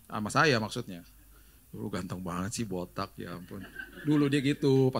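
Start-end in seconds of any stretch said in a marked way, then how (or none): 1.7-3.6 much softer, lower, swooning; soft admiring wife. Retold or imitated. Wife swooning over bald husband, soft and low. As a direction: coo it like a smitten wife.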